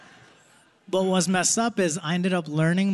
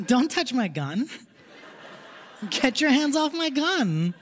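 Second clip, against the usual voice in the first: put-on high, playful warning to a kid; playful scolding, pitched up; mock scolding; 7 semitones higher than usual. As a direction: pretend to scold the kid off your gun. Mock kid scolding about gun.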